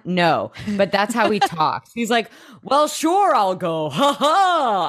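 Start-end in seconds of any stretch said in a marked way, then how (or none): 2.6-4.9 a hint of a hammed-up Gaston; lightly voiced cocky laugh of a showman; Gaston impression. Host mocking Gabriel as Gaston, lightly hammed-up. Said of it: "He did an impression of Gaston?".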